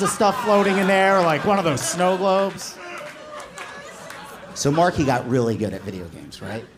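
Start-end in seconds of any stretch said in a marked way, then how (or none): none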